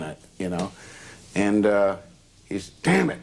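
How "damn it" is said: angry outburst. Retold or imitated. Red swearing as he hits the freezer, angry outburst. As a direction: blurt it out in a burst of temper.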